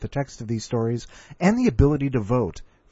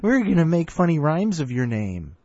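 taunting schoolkid; 8 semitones higher, 3 dB louder than usual. Retold or imitated. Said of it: "they were like".

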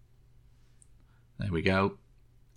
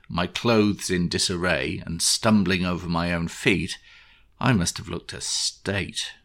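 ordinary delivery

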